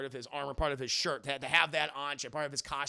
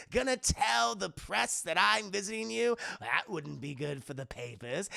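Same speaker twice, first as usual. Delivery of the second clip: theatrical, gleeful villain needling; Joker; 2 semitones higher, 4 dB louder than usual. Acted. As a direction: taunt him playfully, stretching the last words. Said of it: "It's the Joker".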